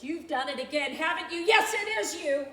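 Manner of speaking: a loud, cutting accusation; bitter accusation. Acted. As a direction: point the finger hard. Accusing Stieglitz.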